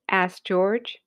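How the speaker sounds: plain reading voice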